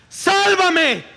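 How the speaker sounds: high, loud cry for help; desperate shout. Acted. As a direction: yell it like a man sinking in the waves. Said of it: "empezó a hundirse y a gritar".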